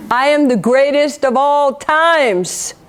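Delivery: slow, drawn-out champion's boast, imitated; Muhammad Ali. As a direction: proclaim it slowly, stretching the last word. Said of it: "Muhammad Ali".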